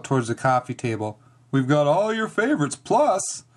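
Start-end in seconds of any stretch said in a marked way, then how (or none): 1.5-3.4 playful announcement, stretched and rising at the end; chuckling dad. A narrator's reading. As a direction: tease the secret like a jolly dad. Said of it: "he winked and chuckled".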